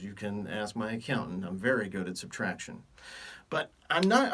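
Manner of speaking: ordinary talk, nothing marked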